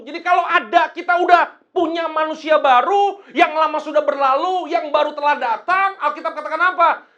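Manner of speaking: loud, high-pitched pulpit delivery; rousing, high and loud sermon shout; forceful preaching. Real, not acted. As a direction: drive it home at full volume. Preaching at full force after reading.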